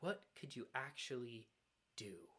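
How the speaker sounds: soft, low and discouraging; hushed, lightly acted voice of doubt; insinuating whisper. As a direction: plant the doubt quietly, letting it sink away. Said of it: "it whispers".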